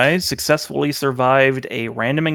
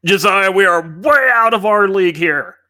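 high, louder panicked yell to a partner; panicked shout; 7 semitones higher, 3 dB louder than usual. Acted. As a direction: call out in alarm. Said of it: "and he shouts".

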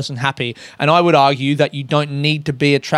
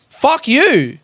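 higher, snapped-off retold retort; quick higher mock retort; retold fuck you; 9 semitones higher than usual. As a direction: fling it back at the agent, pitched up. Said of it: "Hold up".

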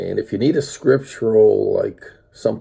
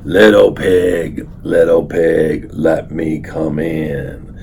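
lower, slow wolf call; slow growly coaxing at the door; 5 semitones lower, much slower than usual. Acted. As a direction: grandpa redoing the wolf for the child, lower and slow. Wolf repeated for the child.

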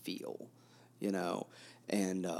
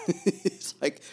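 laughing; 8 semitones higher, 5 dB louder than usual. Real, not acted. Laughs mid-sentence.

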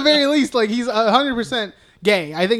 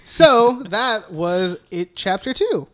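normal talking voice

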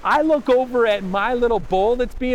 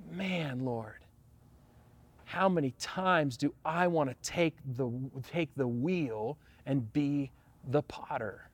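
low, much softer confession; quiet prayer; 8 semitones lower, 11 dB quieter than usual. Real, not acted. Pastor confessing to the Lord, low and hushed. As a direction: murmur it to God, humbled.

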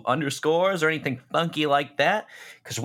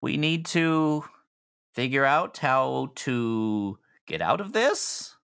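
put-on anxious young hostage; nervous higher voice; 7 semitones higher, slower than usual. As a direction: plead nervously, pitched up. GM voices scared NPC, higher.

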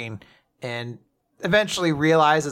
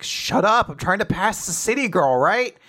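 exasperated inner outburst; 7 semitones higher, 4 dB louder than usual. Acted. Acted annoyed inner thought.

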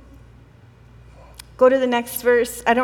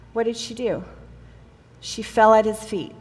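ordinary talk, nothing marked

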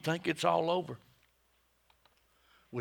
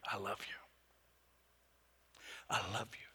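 soft hushed whisper of love; whispered I love you; 6 semitones lower, 10 dB quieter, faster than usual. Shown as a demonstration. God whispering to someone at the end of their rope. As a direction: whisper it gently. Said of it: "You will hear".

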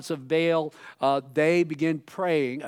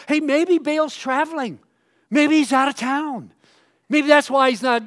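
high, louder sarcastic jeer; mocking; 9 semitones higher, 7 dB louder than usual. Elijah mocking Baal as out of town, raised sarcastic voice. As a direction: jeer at their absent god. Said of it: "And then he says".

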